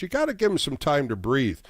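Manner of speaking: ordinary delivery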